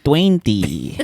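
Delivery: "Twenty" said with a Filipino accent. Shown as a demonstration. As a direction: joke Filipino-accented English. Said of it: "English with a Filipino accent".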